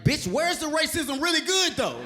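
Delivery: high, loud mock indignation; outraged comeback. Comic answering her Alabama remark, outraged. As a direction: fire back in high-pitched comic disbelief.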